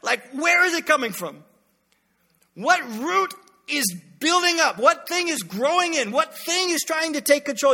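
loud, high self-check; catching yourself mid-thought; stop yourself. Retold or imitated. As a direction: rein yourself in urgently. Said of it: "just be like".

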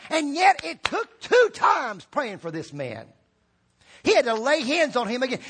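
shouted, high-pitched preaching climax; preaching at full force. Real, not acted. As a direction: build it to a fiery shout from the pulpit.

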